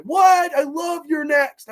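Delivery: high-pitched, incredulous shout; mock shocked fans. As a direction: imitate outraged fans, shrieking in disbelief. Acts out shocked fans' replies.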